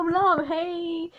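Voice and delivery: ordinary delivery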